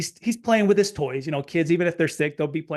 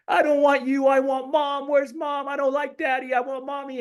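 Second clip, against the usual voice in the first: put-on much higher, whiny little-kid wail; crying toddler voice; 9 semitones higher than usual. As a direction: whine like a sobbing small boy begging for mom. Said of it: "he starts saying".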